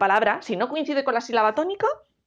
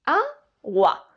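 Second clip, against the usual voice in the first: "Agua" split as a, pause, gua; "Agua" in two detached beats; syllable by syllable; 2 semitones higher than usual. Shown as a demonstration. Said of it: "Separamos en sílabas".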